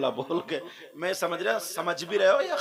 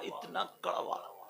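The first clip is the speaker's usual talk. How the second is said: whispered; 8 dB quieter than usual. Acted. Whispered repetition.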